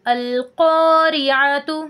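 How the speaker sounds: slow chanted "अल-क़ारिअतु" with a thick, rounded qo; full, heavy qaf. Shown as a demonstration. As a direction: recite "अल-क़ारिअतु" stretching a heavy back q. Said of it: "पुर यानी मोटा पढ़ा जाता है".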